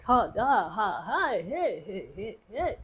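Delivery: sing-song mock jeering "ha" and "hey"; swooping chorus of mock heckles; imitated class uproar. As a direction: mimic students hooting, pitch swooping up and down. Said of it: "When the opera, 'cause you know how".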